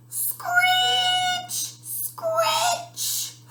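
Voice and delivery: very high, drawn-out screech; eerie night noise, held long and high; imitated screeching noise. A narrator's reading. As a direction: stretch the word into a thin high scrape. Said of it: "What's that noise?".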